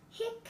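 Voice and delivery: high soft hiccup; a small, high hiccup. Acted. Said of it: "Dad said".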